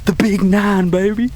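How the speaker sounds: buzzing after the shot; pumped-up hunter bragging after the shot; excited. Real, not acted. Just shot a big buck.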